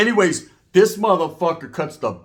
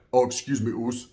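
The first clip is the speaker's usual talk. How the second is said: sheepish big cellmate; 6 semitones lower, 4 dB quieter than usual. Retold or imitated. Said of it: "He was like".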